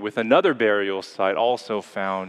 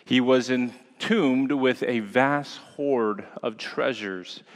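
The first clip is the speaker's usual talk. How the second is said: usual speaking voice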